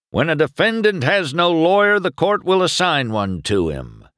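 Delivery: high, projected start, easing down; official proclamation. A narrator's reading. Sheriff telling defendant about a lawyer, proclaiming. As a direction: proclaim the rule to the court.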